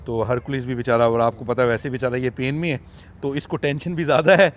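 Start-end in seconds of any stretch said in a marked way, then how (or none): none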